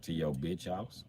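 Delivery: low, slow mutter under the breath. Retold or imitated. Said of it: "I'd be like".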